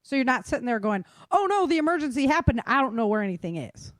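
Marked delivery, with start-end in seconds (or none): none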